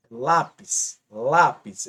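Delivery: "Lápis" with the first syllable leaned on; stress on first syllable. Shown as a demonstration. Teacher showing students the strong syllable, stress on LÁ in "Lápis". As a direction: punch the LÁ in "Lápis". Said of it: "é a sílaba mais forte".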